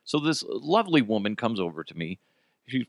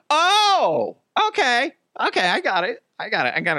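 mock sudden realization; 11 semitones higher, 4 dB louder than usual. Man finally understanding accented woman, exaggerated high realization. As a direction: burst out as if it finally clicks.

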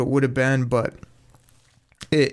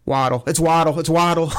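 rising excitement, a bit higher and louder; excited room call; 3 semitones higher, 3 dB louder than usual. Retold or imitated. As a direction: call out the pick as the room erupts. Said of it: "you hear the room just go".

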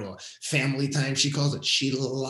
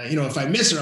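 ordinary talk

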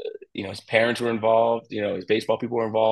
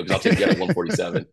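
laughing punchline; 9 semitones higher than usual. Retold or imitated. Retelling banter with his pitcher.

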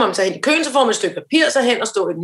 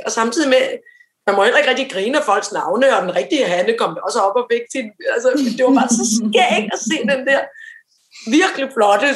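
normal talking voice

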